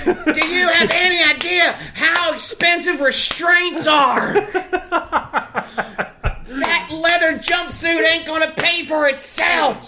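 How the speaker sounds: high, slow hammed-up yelling father; mock dad rant. Dad berating mom about expensive restraints, hammed-up shout. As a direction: rant like an outraged cartoon dad.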